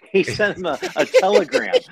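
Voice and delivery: giggling as the punchline word comes out; laughing. Real, not acted. Laughing while retelling the telegram.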